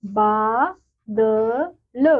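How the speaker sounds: बा द ल very slowly; slow sounding out. Shown as a demonstration. Teacher to children, spelling out slowly. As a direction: split बादल into three slow parts. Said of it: "मकान।".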